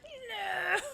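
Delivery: high, drawn-out whiny "no"; reluctant waking groan. Retold or imitated. Streamer replaying waking up that morning, whiny groan. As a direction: whine one long high refusal. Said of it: "was like".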